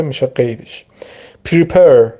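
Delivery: ordinary delivery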